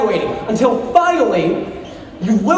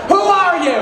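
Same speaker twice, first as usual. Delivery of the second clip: bewildered outburst; 7 semitones higher than usual. Retold or imitated. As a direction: demand it of the stranger in bed. Inner thought staring at spouse.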